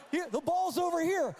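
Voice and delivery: high loud shout across the field; calling out. Retold or imitated. Coach yelling on the field, loud shout. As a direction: yell to the kid.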